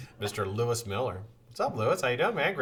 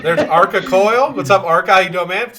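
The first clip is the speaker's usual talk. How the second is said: booming hello, louder and higher; loud greeting; 7 semitones higher, 13 dB louder than usual. Real, not acted. Streamer hailing a chat viewer, loud and excited. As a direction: call out the viewer's name with gusto.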